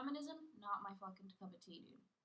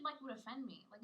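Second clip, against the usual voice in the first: usual speaking voice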